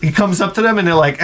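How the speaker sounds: normal talking voice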